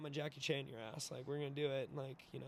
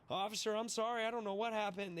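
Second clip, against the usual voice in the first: higher, lightly pleading put-on voice; mock-innocent apology; 5 semitones higher than usual. Skinny buttoned-up guy to police officers, mock innocent. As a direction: play innocent, pitched up a little.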